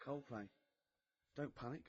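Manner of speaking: soft, offhand song introduction; mock conversational. Shown as a demonstration. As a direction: slip the song title in softly, like conversation. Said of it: "making it sound like it was".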